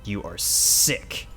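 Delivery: very slow, disgusted verdict. A narrator's reading. Father condemning child, disgusted. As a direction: stretch each word with disgust. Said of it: "Dad looks downright disgusted now".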